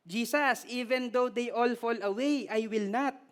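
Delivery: put-on Filipino accent. Preacher voicing Peter in a Filipino accent. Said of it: "Pagka Pilipino yung accent".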